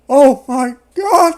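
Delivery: big gasp, then high, loud cry; overdone shocked outburst, drawn out; mock shock. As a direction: gasp and exclaim in hammed-up horror. Acting shock at the vanished bear.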